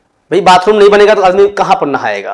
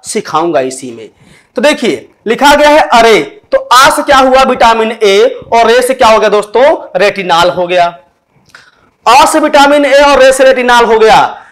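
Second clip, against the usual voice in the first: ordinary talk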